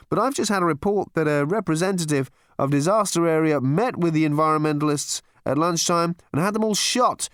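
cheerful live report from the desert; radio reporter. Acted. Reporter broadcasting the concert, high breezy reporter voice.

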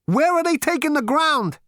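very high, loud, bewildered cry; aghast. Acted. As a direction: shout the question in disbelief. Aghast as the ground disappears.

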